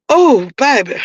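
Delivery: strained from coughing fit; winded, coughing voice; after coughing. Real, not acted. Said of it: "I was smoking".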